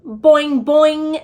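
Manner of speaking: high, loud froggy boing. A narrator's reading. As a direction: frog voice boinging back. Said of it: "said Og".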